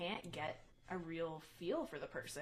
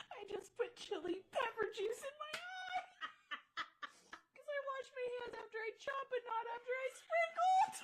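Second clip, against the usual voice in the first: very high squeal through laughter and tears; high tearful wail breaking into laughs; laughing and crying. Real, not acted. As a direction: squeal in real pain, laughing helplessly as you cry. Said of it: "Oh, she's crying".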